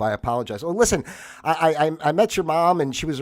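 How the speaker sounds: ordinary talk, nothing marked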